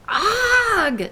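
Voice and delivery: loud high spluttering ugh; disgusted ugh. Acted. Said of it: "she sputtered".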